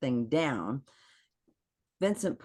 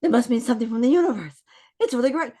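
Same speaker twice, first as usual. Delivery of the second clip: high, fast, giddy mock gush; bubbly mock delight, pitched up high; giddy excitement; 10 semitones higher, 7 dB louder, faster than usual. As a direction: gush like the universe sent a sign. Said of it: "it was a part of me going".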